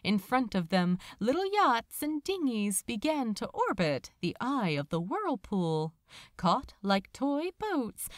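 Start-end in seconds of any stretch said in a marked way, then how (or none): none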